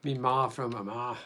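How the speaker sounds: put-on broad Irish accent. Streamer showing chat his good Irish accent, in a thick Irish accent. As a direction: lay on a thick Northern Irish brogue. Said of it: "I've been told I do a good Irish accent".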